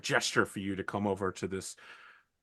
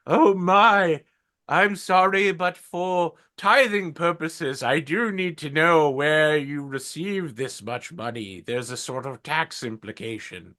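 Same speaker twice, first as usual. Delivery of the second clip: put-on very old man; 6 semitones higher than usual. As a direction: play a frail ninety-year-old cleric. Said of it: "I really hurt my throat doing the old man's voice".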